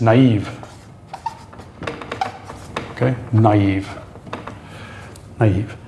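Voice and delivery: "naive" as na-EEV; slow, clearly split "naive"; two separate vowels. Shown as a demonstration. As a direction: break "naive" into ah then ee. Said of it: "you're saying naive".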